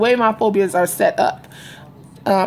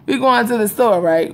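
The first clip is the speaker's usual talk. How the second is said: ordinary talk, nothing marked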